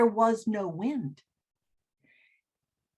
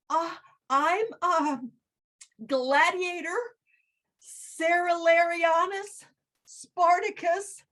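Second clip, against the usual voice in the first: much higher, nervous small voice; scared gladiator giving his long name; timid little gladiator; 8 semitones higher, 4 dB louder than usual. Acted. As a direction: answer like a frightened little boy.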